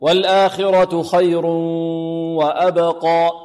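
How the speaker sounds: slow recitation, long nasal hum from خير into وأبقى; nasal ghunna. Shown as a demonstration. Teacher shows the nasal passage, خير humming into وأبقى. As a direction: send the join of خير and وأبقى through the nose. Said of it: "الذي يخرج من الخيشوم".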